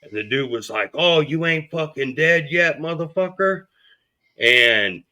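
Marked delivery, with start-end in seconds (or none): 0.9-3.7 a higher, altered voice for the gunman; drugged attacker taunt. Retold or imitated. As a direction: sneer it like someone out of his mind. The high attacker taunting him.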